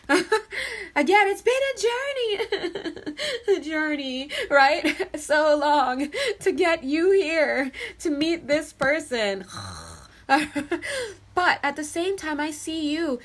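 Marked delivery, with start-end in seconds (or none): 0.1-3.6 high-pitched, slow, breaking into laughter; can't get the words out for giggling; laughing through words. Real, not acted. Tarot reader to Taurus, laughing through the line. Reader cracks up repeating phrase. 3.6-10.5 louder, giggling through every word; words spilling out between laughs; laughing. Real, not acted. Tarot reader laughing at her own joke, giggling.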